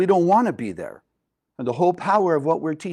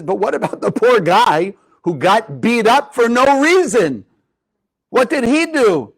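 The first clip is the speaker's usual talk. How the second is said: student indignantly questioning his teacher; 6 semitones higher, 5 dB louder than usual. Acted. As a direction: push back in disbelief at the injustice.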